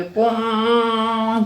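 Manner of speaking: long drawn-out hooting pon like a conch; conch blow imitation. Shown as a demonstration. Teacher showing children the temple conch, long drawn hoot. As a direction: stretch the pon out like blowing a conch. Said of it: "शंख बजाते होंगे".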